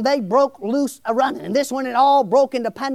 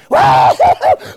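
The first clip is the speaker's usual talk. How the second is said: a high, loud burst of laughter; joyful shouting laugh; 11 semitones higher, 8 dB louder than usual. Real, not acted. Preacher to the congregation, a loud gleeful laugh. Preacher overjoyed at offering news.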